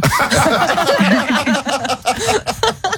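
long high-pitched helpless laugh; real laughter. Real, not acted. Host cracking up at caller's cuddly toy story, real high laughter.

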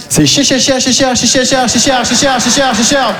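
rapid repeated stammering cher; mock stammer. Comedian mocks his stutter over the price, rapid repeated stammer.

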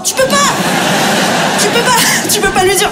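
high, insistent exasperation; comic disbelief; exasperated. Real, not acted. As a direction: plead with the crowd that you just can't.

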